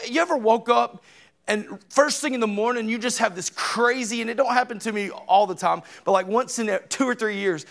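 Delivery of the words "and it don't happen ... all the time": usual speaking voice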